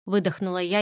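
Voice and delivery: ordinary delivery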